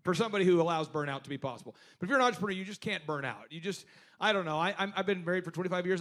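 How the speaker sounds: ordinary talk, nothing marked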